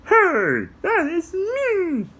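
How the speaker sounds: high, slow squeaky puppet; proud little puppet claiming it; high puppet voice, drawn out; Puppy puppet voice. Acted. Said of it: "like Puppy".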